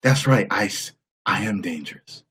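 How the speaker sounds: a hint of Tom Cruise bravado; Tom Cruise impression. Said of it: "Here's my impression of Tom Cruise".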